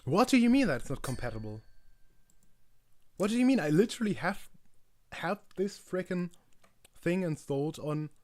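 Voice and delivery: high, loud indignant rant; real disbelieving outburst; exasperated rant. Real, not acted. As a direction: vent the fury at the screen. Ranting at incompatible extension.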